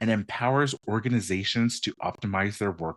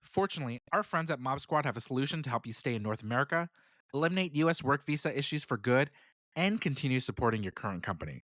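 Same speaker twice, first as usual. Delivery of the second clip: quick, softer sponsor read; 6 dB quieter than usual. Acted. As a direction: read the ad copy briskly and smoothly.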